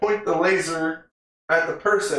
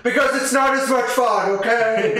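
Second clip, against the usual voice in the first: a high, louder, laughing mock answer; tizio che ride; 10 semitones higher, 4 dB louder than usual. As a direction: toss it off like a grinning rich guy. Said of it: "And the guy's like".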